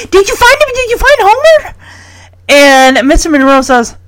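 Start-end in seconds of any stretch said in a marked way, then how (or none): 0.1-1.7 high, eager, repeated question; impatient child, pitched way up; antsy excitement. Retold or imitated. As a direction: imitate a kid with ants in his pants. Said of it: "ants in his pants, just like".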